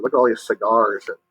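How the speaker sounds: usual speaking voice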